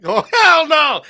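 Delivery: high, loud shout with a laugh; thrilled disbelief. Retold or imitated. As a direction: burst out in delighted shock. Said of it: "And I was like".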